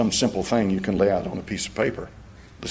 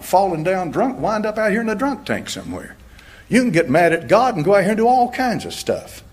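fiery preaching; 8 semitones higher than usual. Acted. Preacher warns the congregation about getting mad at God, full-force preaching.

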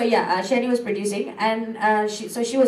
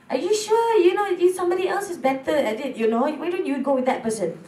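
a higher, needling voice questioning herself; doubting inner voice; 6 semitones higher than usual. Retold or imitated. Her inner voice telling her others do it better, higher needling tone. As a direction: poke at yourself like a nagging inner critic, pitched up.

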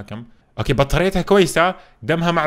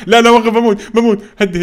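loud, high urgent shout; panicked plea; 8 semitones higher, 10 dB louder than usual. Real, not acted. Player begging to stop before dying in game, panicked plea. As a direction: yell for them to stop at once.

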